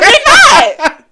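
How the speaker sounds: high, loud, laughing refusal. Retold or imitated. Stopping a friend from quitting, loud laughing refusal. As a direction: shout her down with a laugh.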